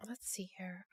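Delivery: soft unvoiced whisper to herself; whispered to self. Real, not acted. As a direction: whisper it while looking something up. Host mutters while searching questions.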